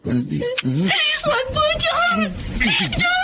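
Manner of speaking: terrified scream. Acted. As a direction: scream for him to stop. Girl screams as she is attacked. (sumisigaw sa takot)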